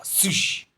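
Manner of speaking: high, hushed sharp swish; imitated swish. A narrator's reading. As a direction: voice a sudden swishing noise. Comic sound effect read aloud.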